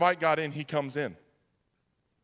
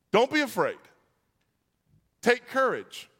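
high, emphatic call over a storm; proclaiming; 5 semitones higher than usual. Acted. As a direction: proclaim each phrase firmly, with pauses between. First take of Jesus's three things.

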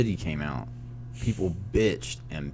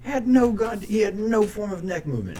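excited rant; 14 semitones higher, 3 dB louder than usual. Real, not acted. Mocking Keaton's stiff neck.

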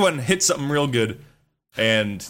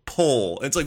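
ordinary talk

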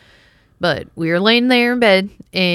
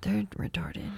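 hushed whispered remark; whispered aside; 14 dB quieter than usual. Real, not acted. Joking about dogs licking windows, whispered aside. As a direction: whisper it as a throwaway comment.

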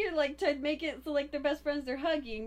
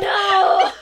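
loud laughing disbelief; shocked no; 6 semitones higher, 16 dB louder than usual. Real, not acted. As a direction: cry out in shocked delight. Reacting to the quiz answer.

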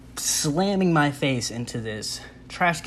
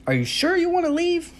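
much higher, sing-song pleading receptionist; mock desk lady. Receptionist begging the leaving patient, in a high mock voice. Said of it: "She says".

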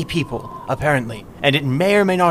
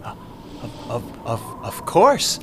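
terrified stammer; 4 semitones lower, slower than usual. Acted. Terrified captive stammers under threat.

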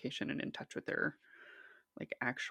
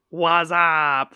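very loud, drawn-out mock greeting; Neil Lane; 15 dB louder than usual. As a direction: blare it out, stretched and hammed-up. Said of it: "And Neil Lane going".

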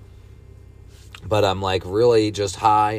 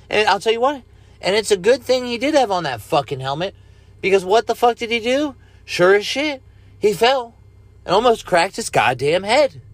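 excited rant; 11 semitones higher, 4 dB louder than usual. Real, not acted. Ranting about president's fall.

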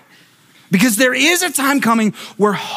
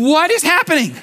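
bewildered laughing; 7 semitones higher than usual. Retold or imitated. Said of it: "blind guy going".

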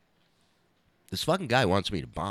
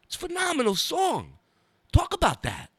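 pushy, higher-pitched comic star impression; Dice; 8 semitones higher than usual. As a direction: imitate him talking up the song, high and proud. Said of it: "He goes".

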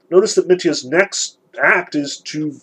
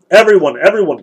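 loud call to the whole table; cried out; 4 semitones higher, 7 dB louder, faster than usual. Acted. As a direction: summon everyone with a loud cry. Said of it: "cried Mitya".

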